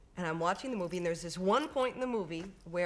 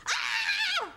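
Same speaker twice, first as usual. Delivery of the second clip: full-force high horror-movie scream; demonstration scream; 6 dB louder than usual. Shown as a demonstration. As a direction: let out a piercing film scream on demand. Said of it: "the last scene is a ten".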